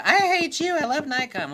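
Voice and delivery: high, loud whiny fan outburst; mocking angry Nikon fan. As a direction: shriek it like an offended fanboy. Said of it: "other people are gonna die".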